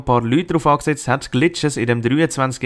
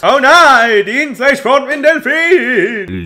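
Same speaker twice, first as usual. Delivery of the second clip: loud high hammed-up panic; mock panic; 10 semitones higher, 4 dB louder, slower than usual. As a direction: cry out in fake alarm.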